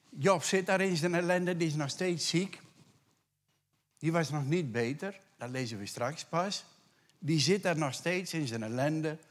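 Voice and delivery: fervent preacher, ringing out; preaching at full force. Acted. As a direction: proclaim God's command to the congregation. Preaching Job's misery fervently.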